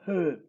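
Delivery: "he" with a breathy h before the E; aspirated E. Shown as a demonstration. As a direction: demonstrate "he" as an aspirated syllable. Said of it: "La E primera es como que se aspira".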